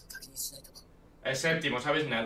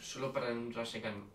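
whisper; 10 semitones lower, 11 dB quieter than usual. Real, not acted. Susurra en plena reacción.

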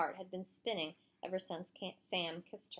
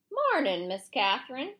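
lazy greeting; 5 semitones higher, 5 dB louder than usual. A narrator's reading. Drunk sheriff greets her.

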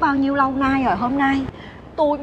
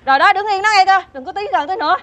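high, insistent warning to stay away; hammed-up wariness; alarmed warning; 6 semitones higher than usual. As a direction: keep them at a distance, alarmed. Warding off the supposed pervert.

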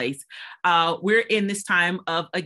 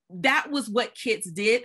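normal talking voice

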